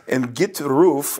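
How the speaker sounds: slow, mock Russian accent; slow Russian-accented imitation; imitated Russian accent. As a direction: do the seminar speaker's Russian accent. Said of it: "taking notes in your accent".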